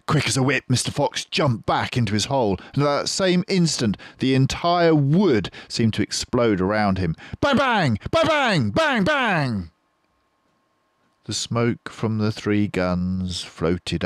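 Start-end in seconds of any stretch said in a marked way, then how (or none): 0.1-7.2 high, loud, breathless urgency; urgent. A narrator's reading. Narrator telling the fox's escape, urgent and loud. 7.4-9.7 high, loud, shouted bang bang; gunshot bangs. A narrator's reading. Narrator imitating the three guns, shouted bangs.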